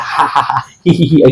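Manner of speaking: imitated silly "ha-ha, hee-hee" laugh; put-on idiotic giggle; mock foolish laugh. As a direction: fake a foolish chuckle, ha-ha turning to hee-hee. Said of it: "ऐसा करके".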